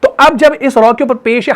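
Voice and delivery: ordinary talk, nothing marked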